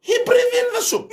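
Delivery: laughs out the punchline, pitch jumping high; can barely get the words out for laughing. Real, not acted. Punchline of a funny story.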